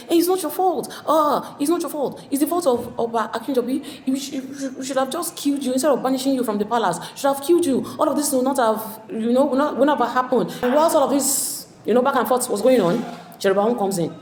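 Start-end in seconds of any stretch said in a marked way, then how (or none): 0.1-10.5 higher, mock-sympathetic jeer that turns nasty; mocking sneer. Ajanaku jeering at the banished Iyaba, mock-pitying sneer. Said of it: "Ajanku is like".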